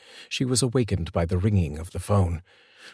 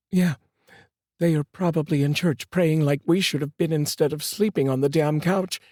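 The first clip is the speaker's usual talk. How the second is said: bitter, higher mother's voice; angry; 8 semitones higher than usual. A narrator's reading. Mother blaming herself to the detective, mildly angry. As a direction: spit it out with mild anger. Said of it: "in an angry tone".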